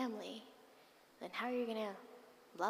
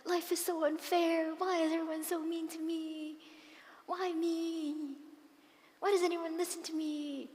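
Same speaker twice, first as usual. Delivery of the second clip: high, loud wailing complaint; child sobbing to herself; crying self-pity; 8 semitones higher, 7 dB louder than usual. Retold or imitated. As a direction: wail it like a hurt child alone in a room. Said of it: "I was crying and crying and crying".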